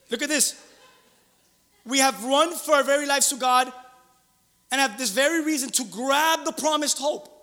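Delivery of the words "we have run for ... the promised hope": loud, raised, emphatic verse reading; forceful scripture proclamation. Real, not acted. Preacher reads one translation to the congregation, forcefully.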